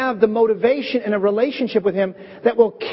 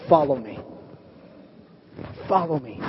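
gentle Jesus; 7 semitones lower, 6 dB quieter than usual. Retold or imitated. Jesus' final call to Peter.

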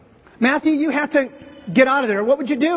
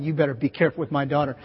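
low, quick warning from a protective father; protective dad voice; 8 semitones lower, 5 dB quieter, faster than usual. Retold or imitated. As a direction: mutter it like a watchful dad. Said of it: "Andre's back there going".

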